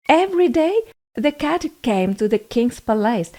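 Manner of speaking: plain reading voice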